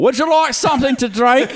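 Australian host suddenly yelling; mock Australian accent. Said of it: "when they whisper, it's always in an American accent".

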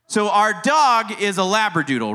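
ordinary talk

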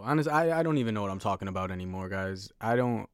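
ordinary talk

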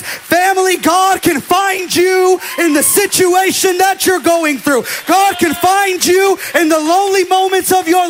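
loud, high, shouting sermon climax; full-force preaching. Acted. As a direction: preach it to the whole room, building hard.

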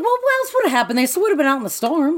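high, rushed protest; squeaky, hurried objection; mock indignation. Real, not acted.

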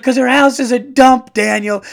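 high, loud mock retort; imitating the mom. Mom snapping back at the dad in the sketch, high and loud. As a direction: fire back like the exasperated mom. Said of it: "And she's like".

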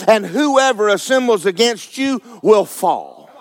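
slow, high, ringing declamation; preacher's drawn-out, fired-up reading; emphatic scripture proclamation. Real, not acted. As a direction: proclaim the verse with stretched-out words. Declaims scripture at full force.

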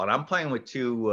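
ordinary talk

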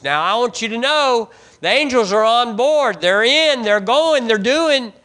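high, loud, driving sermon burst; full-force preaching. Acted. Preacher tells the congregation the angels are on board, fiery. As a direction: push it up and out with fire.

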